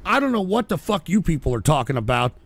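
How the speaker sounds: ordinary delivery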